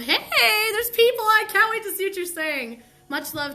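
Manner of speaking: light up as viewers arrive; excited greeting. Real, not acted. Greeting viewers joining live chat.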